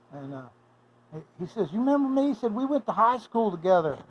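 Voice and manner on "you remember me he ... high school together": high, eager imitated voice; eager old friend. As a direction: voice him pitched up and keen to be remembered. Old classmate greets Mike eagerly.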